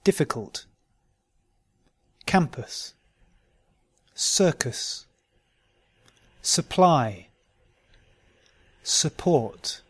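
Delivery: weak U said "uh", as in "Campus"; "Supply" and "Support" begun with unstressed "suh"; careful model words, "Circus" ending in "-kus"; schwa for U. Shown as a demonstration. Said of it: "where a or schwa is spelt with a U".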